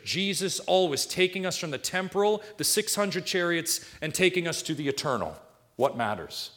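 high, driving scripture delivery; forceful preaching. Real, not acted. Preacher voicing Jesus' warning to repent, forceful preaching.

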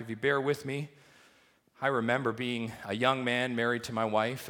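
ordinary talk, nothing marked

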